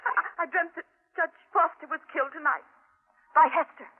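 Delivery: tearful, shaking account of a nightmare; crying out her dread; tearful terrified telling; sobbing fear. Acted. Wife recounts the dream.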